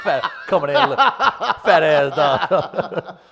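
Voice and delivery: slow, laughing ridicule of the dog; laughing mockery. Teasing Chris about his dog, laughing slowly. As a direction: crack up over the fat dog.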